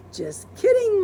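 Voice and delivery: playful wife; teasing. Acted. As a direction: toss it back sweetly.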